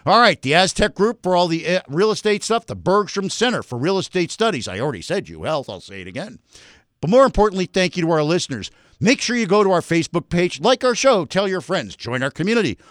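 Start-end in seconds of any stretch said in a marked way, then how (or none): 4.7-6.4 low-pitched throwaway aside, fading softer; quiet aside. Real, not acted. Radio host checks own list of thanks, quiet aside. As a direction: drop low and soft, as to yourself.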